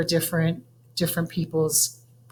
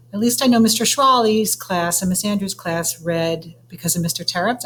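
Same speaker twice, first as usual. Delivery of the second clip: plain reading voice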